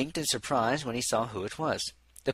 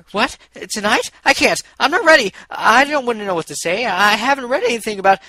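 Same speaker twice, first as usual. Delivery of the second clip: high, loud nervous panic; panicked; 10 semitones higher, 12 dB louder than usual. Acted. Shy unicorn panicking to her friend about meeting him, high and loud. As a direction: blurt it out in rising panic.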